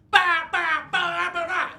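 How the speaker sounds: high, noisy imitated band blasts. Comic showing a band's wild energy, high imitated blasts. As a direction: bark out pounding rave noises. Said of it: "The Prodigy energy is like".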